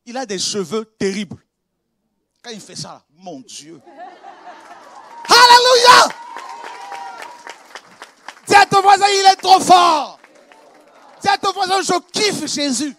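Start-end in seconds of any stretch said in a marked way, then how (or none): 5.3-12.9 loud, high, slow preacher shout; rousing call to the crowd. Acted. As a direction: whip the congregation up, shouting. Preacher calls out to the congregation.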